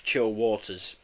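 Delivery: ordinary delivery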